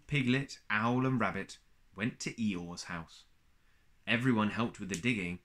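ordinary delivery

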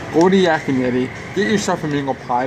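normal talking voice

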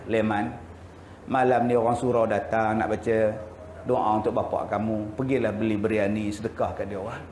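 a hint of a grieving mother; lightly voiced widow. Widow instructing her son. (ibu yang sedih bercakap perlahan)

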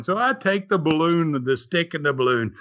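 ordinary delivery

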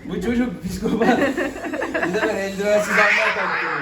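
loud, high and drawn out through laughter; laughing alarm. Real, not acted. Laughing alarm over the wild cat. (kahkahayla, yüksek sesle yakınma)

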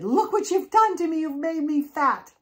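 high, loud angry outburst; furious accusation. Acted. Molly confronts the witches at their door, furious accusation. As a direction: shriek the blame at them.

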